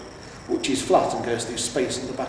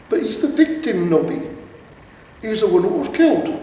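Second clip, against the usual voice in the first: louder, higher, fed-up sergeant; exasperated correction; 8 semitones higher, 6 dB louder than usual. A narrator's reading. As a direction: correct the idiot loudly. Colon corrects Nobby, exasperated.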